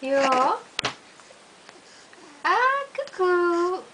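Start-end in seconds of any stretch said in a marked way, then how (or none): 2.4-3.8 high, drawn-out peekaboo call; playful high coo. Pretend. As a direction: sing it out high to a baby. Playing peekaboo with the baby.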